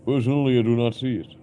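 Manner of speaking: usual speaking voice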